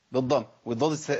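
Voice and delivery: ordinary talk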